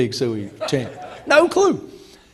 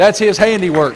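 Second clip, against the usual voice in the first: normal talking voice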